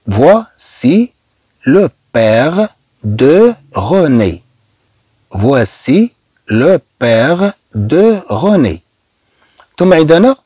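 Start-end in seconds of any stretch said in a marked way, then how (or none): 0.1-4.4 very slow, pausing between words; careful, drawn-out teacher's demonstration; word-by-word model. Shown as a demonstration. Teacher breaks sentence into words. 5.3-8.8 very slow, a gap after each word; careful, drawn-out French sentence demonstration; word-by-word model. Shown as a demonstration. Teacher repeats sentence word by word.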